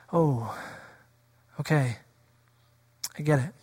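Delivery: low, soft defeated sigh of a reply; resigned mutter. Retold or imitated. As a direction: give in quietly, deflated. Resigned quote of defeated believer.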